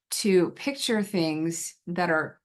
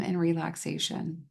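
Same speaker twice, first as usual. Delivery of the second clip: calm guided relaxation with long pauses between phrases; guided visualization. Shown as a demonstration. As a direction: lead them gently into calm, leaving space to breathe.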